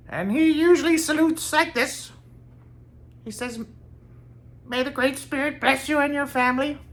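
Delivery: very high-pitched character voice; high voice of an odd, happy character; Bobby Justin impression. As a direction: put on a high, loud eccentric voice. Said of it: "I have to do a Bobby Justin impression for you".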